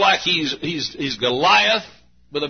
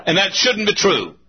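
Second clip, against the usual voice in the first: usual speaking voice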